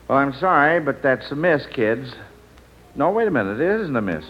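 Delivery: normal talking voice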